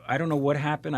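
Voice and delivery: ordinary delivery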